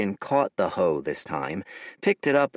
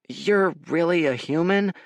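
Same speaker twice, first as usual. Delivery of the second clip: hesitant; 8 semitones higher than usual. A narrator's reading. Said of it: "said the boy hesitantly".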